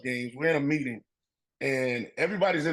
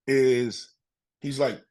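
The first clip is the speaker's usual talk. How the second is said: normal talking voice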